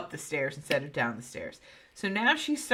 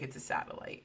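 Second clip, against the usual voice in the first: muttered dismissal; 13 dB quieter, faster than usual. Retold or imitated. Ken flatly dismissing her.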